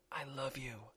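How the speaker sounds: tender, barely-there whisper; mouthed whisper. Acted. Wife mouthing love to husband, silent-like whisper. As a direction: breathe the words with no voice. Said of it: "she mouthed to him".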